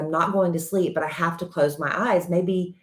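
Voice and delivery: ordinary talk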